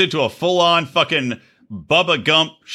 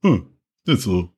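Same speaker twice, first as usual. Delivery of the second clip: lower, lightly put-on mock voice; Odie impression; 7 semitones lower than usual. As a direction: impersonate a cohost, teasing him. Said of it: "that's my Odie impression".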